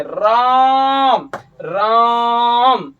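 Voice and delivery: राम drawn out very long, sung-like; prolonged pluta vowel. Shown as a demonstration. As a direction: stretch the आ in राम far out. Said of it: "जितना दूर खींच सकते हैं". (राम को खूब खींचकर बोलना)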